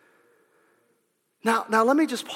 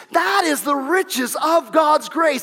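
louder, rising preacher's cadence; fiery preaching; 5 semitones higher, 5 dB louder than usual. Real, not acted. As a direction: build each line like a sermon climax. Preaching at full force.

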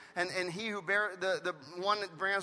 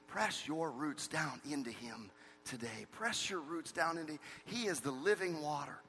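tender plea; 4 semitones lower, 8 dB quieter than usual. Acted. Pastor softly pleads with the dry.

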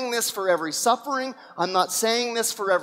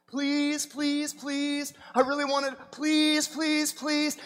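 begging; 5 semitones higher, 5 dB quieter, slower than usual. Acted. Believer begging God for job.

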